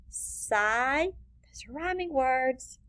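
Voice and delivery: usual speaking voice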